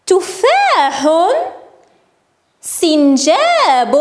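very slow, drawn-out vowels; slow word modelling. Shown as a demonstration. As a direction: say each word slowly for young learners. Teacher models list of words.

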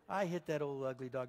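ordinary talk

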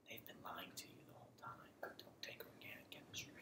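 hushed conspiratorial whisper, fast; secret whisper. Pretend. Conspiratorial warning about organic chemistry.

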